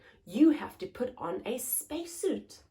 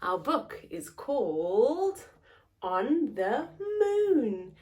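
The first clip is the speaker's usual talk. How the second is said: ordinary delivery, nothing marked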